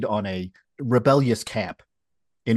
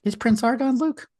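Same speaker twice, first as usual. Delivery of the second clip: incredulous; 14 semitones higher than usual. Real, not acted. Mock doubtful question.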